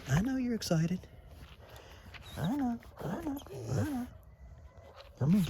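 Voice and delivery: high soothing dog talk; squeaky praising a dog. Real, not acted. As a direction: coo to calm the excited puppy.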